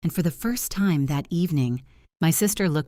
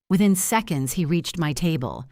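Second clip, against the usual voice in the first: plain reading voice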